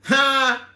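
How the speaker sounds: a sharp "Ha!" into a loud laugh. Real, not acted. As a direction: burst out laughing at the chat. Bursts laughing at chat message.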